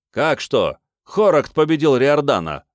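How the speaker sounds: barked shout. A narrator's reading. Trainee shouts the duel result to the instructor, barking. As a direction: bellow out the news. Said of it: "гаркнул Тиллер".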